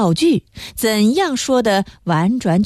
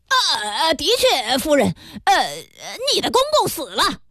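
hesitant, higher voice, then a quick blurt; flustered blurting; 7 semitones higher than usual. A narrator's reading. Pharmacist breaking a death to Emma, flustered and abrupt. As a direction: stumble over fillers, then blurt it out.

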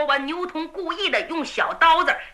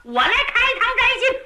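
higher, rapid, gleeful yelling; shouting; 8 semitones higher, faster than usual. A narrator's reading. Tang Qiong yelling his gruesome plan at the captive. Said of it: "汤琼金喊".

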